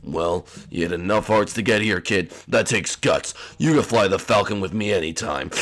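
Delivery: deep, low-key captain voice; Han Solo. Acted. Rescued pilot offering a flight in the Falcon, low hero voice. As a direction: praise the kid in a low put-on voice. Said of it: "Really, Han Solo?".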